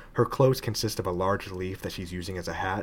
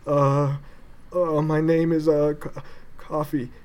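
high, stammering little chimp; panicked stutter, voice pitched up; nervous stammer; 6 semitones higher, 4 dB louder, slower than usual. Acted. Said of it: "Coffee starts to panic".

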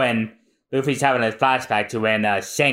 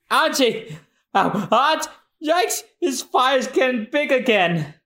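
panicked pirate; 13 semitones higher than usual. Acted.

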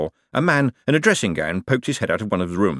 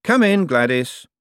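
raised, slow call to the door; said aloud; 7 semitones higher, 4 dB louder, slower than usual. A narrator's reading. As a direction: call out politely, pitched up. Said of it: "but said aloud".

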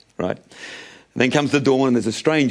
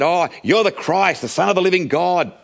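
higher-pitched emphatic declaration; 6 semitones higher than usual. Acted. Peter declaring who Jesus is, emphatic and raised in pitch. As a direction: proclaim it with full conviction. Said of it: "the one who emphatically said".

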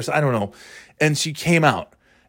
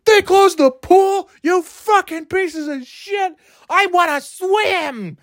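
very high, slow whiny shriek; mock tantrum; slower than usual. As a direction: wail it like a spoiled kid. Mock tantrum over closed pool.

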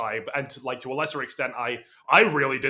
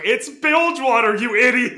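mocking correction; 9 semitones higher, 9 dB louder than usual. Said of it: "[name] is just making fun of him".

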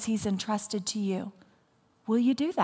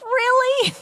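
reluctant protest; 12 semitones higher, 5 dB louder, slower than usual. Retold or imitated. I'm like.

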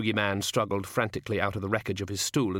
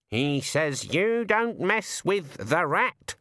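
slow, much higher croaking bird voice; talking raven; 7 semitones higher, much slower than usual. A narrator's reading. The raven telling Susan her grandfather's gone mad, high slow bird voice. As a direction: speak like a sardonic raven.

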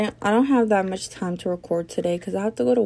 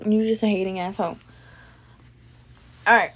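ordinary talk, nothing marked

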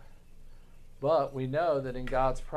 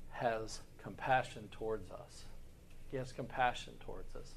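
much softer, drawn in and tender; tender hush; 5 semitones lower, 14 dB quieter than usual. Real, not acted. Preacher to congregation on God's compassion, softly tender. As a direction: let it sink in gently, almost to yourself.

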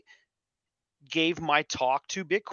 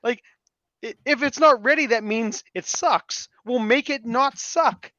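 high, louder mock exasperation; exasperated retort; 7 semitones higher, 5 dB louder than usual. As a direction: replay your baffled pushback, pitched up. Acted retort to the sceptical designer.

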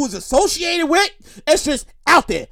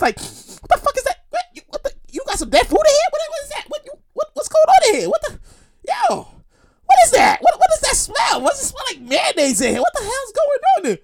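high-pitched, sputtering disbelief; hammed-up, much higher flustered voice; mock disgusted visitor; 11 semitones higher than usual. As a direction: stammer in a squeal, reeling from a stink. Acts visitor hit by awful smell.

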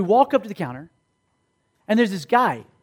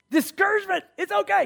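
upbeat reassurance; 13 semitones higher than usual. Retold or imitated. Acting out his upbeat reaction.

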